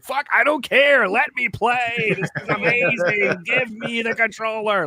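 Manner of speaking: high, slow, pleading cry for the controller; desperate begging. Retold or imitated. Kid begs stepbrother for the controller, high desperate plea. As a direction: beg like a kid who can't wait any longer. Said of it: "Like, I just was desperate".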